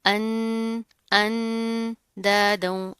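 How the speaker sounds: अन with a long hummed n; slow अंदादों, lingering nasally on the n; held nasal ghunnah. Shown as a demonstration. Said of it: "गुन्ना करेंगे". (अंदादों, गुन्ना खींच कर)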